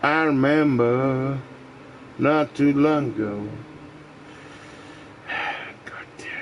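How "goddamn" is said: breathless whispered curse; sighed whisper. Real, not acted. Worn out by the earworm, whispered sigh. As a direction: let it out on a sigh. Sighing about the song.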